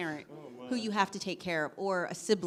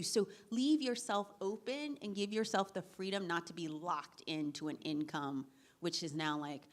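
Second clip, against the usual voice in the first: ordinary talk, nothing marked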